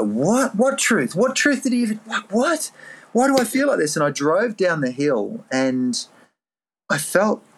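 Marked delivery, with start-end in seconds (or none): 0.0-3.9 high, retold baffled what-what; bewildered questioning. To the inner voice of wisdom, baffled and high. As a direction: puzzle it out in disbelief.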